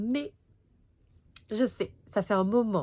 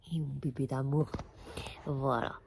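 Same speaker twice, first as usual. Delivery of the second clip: tender to dog; 7 semitones lower, 5 dB quieter than usual. Real, not acted. Talks tenderly to sleeping dog.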